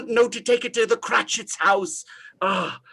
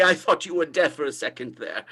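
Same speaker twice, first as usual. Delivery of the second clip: ordinary talk, nothing marked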